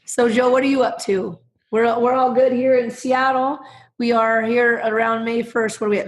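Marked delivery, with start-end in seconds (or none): none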